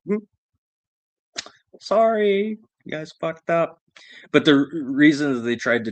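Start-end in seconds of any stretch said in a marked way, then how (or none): 0.1-3.8 1 mock sorry. Mocking the cops in retelling.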